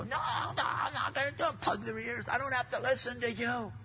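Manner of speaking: very high mock yell, held back in volume; imitated child's tantrum. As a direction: play a child refusing with ears plugged. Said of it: "temper tantrums and start yelling".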